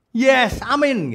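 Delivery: high, loud, drawn-out gleeful amen; mock congregation cheer. Congregation hearing the promise of blessing, mock cheering. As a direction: mimic an over-eager churchgoer's slow cheer.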